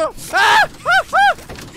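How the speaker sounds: very high, loud terrified screams; repeated real screams of fear; panicked scream. Real, not acted. Chased by monster in game.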